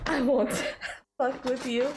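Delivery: slips into a thick Southern accent, laughing midway; Southern twang, breaking into a laugh; a laughing Southern drawl. Real, not acted. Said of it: "that sounded really southern".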